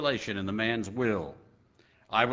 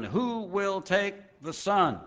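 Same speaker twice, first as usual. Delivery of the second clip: higher, slow auctioneer's call; 8 semitones higher, slower than usual. A narrator's reading. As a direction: draw it out like an auctioneer begging for a bid. Auctioneer calling for bids.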